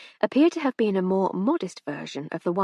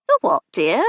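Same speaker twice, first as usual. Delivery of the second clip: aunt; 7 dB louder than usual. A narrator's reading. Deaf elderly aunt asks.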